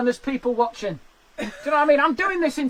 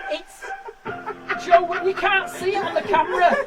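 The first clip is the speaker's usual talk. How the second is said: high, laughing through cramp; laughing in pain; 5 semitones higher, 3 dB louder, slower than usual. Real, not acted. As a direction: laugh while wincing. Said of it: "Got cramp".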